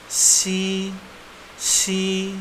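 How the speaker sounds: "si" drawn out on a high level tone; Mandarin X sound. Shown as a demonstration. Teacher showing how X is read, long high "si". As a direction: hold each "si" long and even, high. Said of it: "X itu dibaca S".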